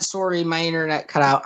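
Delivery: ordinary talk, nothing marked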